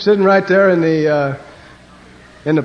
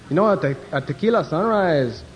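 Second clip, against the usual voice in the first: put-on Mexican accent. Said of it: "the one Mexican said".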